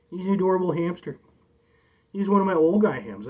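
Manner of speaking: ordinary talk, nothing marked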